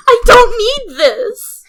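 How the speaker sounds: high, loud, slow wail; wailing fangirl. Real, not acted. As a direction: wail in overwhelmed delight. Overwhelmed fan squealing.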